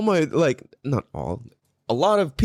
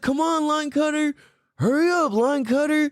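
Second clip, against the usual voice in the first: high sing-out taunt; mock-impatient high call; retold heckling yell; 12 semitones higher than usual. Driver hurrying the line cutter along, retold heckle. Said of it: "what I yelled at her".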